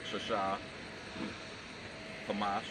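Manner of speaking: usual speaking voice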